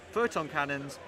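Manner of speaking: ordinary delivery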